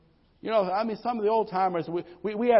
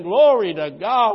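slow, loud praise shout; joyful praise; 4 semitones higher, 9 dB louder, slower than usual. Acted. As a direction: lift it up to God, slowly. Acting joyful church praise.

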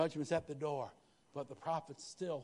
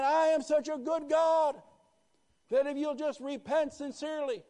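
shouting God's words; 14 semitones higher, 13 dB louder than usual. Acted. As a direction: cry it out as God's own promise. Preacher voicing God, climax.

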